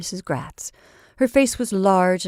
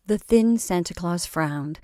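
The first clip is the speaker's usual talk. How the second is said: ordinary delivery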